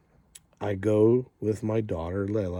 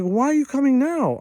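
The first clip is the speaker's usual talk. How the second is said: high, loud mimicked disbelief; acted incredulity; 6 dB louder than usual. Hospital worker asks the rider about his delay, acted incredulity. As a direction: imitate a baffled demand for a reason. Staff incredulous at late visit.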